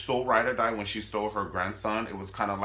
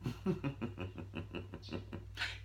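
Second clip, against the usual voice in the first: slow, quiet villain laugh; evil chuckle; 2 semitones lower, 11 dB quieter than usual. Retold or imitated. Playing the evil mother after her warning, sinister chuckle.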